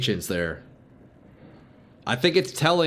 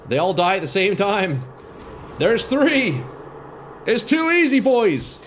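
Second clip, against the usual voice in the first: excited; 9 semitones higher, 4 dB louder than usual. Real, not acted. Boss pack dying, excited.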